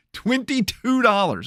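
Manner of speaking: can't believe the price; outraged disbelief. Real, not acted. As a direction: stretch it out in shocked outrage.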